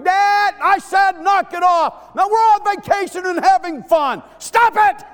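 put-on high loud little girl shouting; angry child's yell. Daughter yelling at her sisters in the van, high and loud. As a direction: yell it like a fed-up kid.

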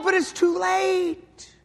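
high, loud, shouting preacher; pulpit-pounding revival preaching; preaching at full force. Acted. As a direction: build each line like a hammer blow. Preacher describing hell.

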